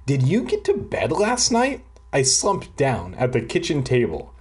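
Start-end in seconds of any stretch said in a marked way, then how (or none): 0.1-1.8 voicing mom. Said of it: "Mom said".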